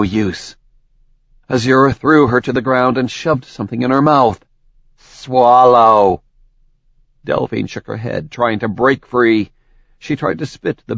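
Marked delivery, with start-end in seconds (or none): 5.0-6.2 commanding. Acted. Villain commanding.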